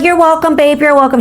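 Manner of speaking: high singsong teen imitation; mock sarcastic sweetness. Teenager blowing kisses at her, high sarcastic imitation.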